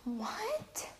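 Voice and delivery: hushed, whispered shock; devastated mom. Retold or imitated. Mom hearing her daughter has lice, whispered dismay. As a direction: gasp it softly, as an aghast mother. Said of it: "she's so devastated".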